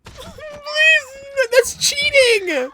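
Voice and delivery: high, whiny outraged cry; raging gamer. Real, not acted. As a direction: wail at the unfair game. Raging at the game.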